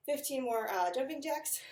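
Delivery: breathless and panting between words; out of breath. Real, not acted. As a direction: gasp it out, out of breath. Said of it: "I can't catch my breath".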